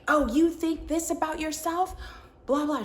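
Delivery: high-pitched, sneering mimicry of a vicious woman; mock venomous. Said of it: "she's, like, spitting venom".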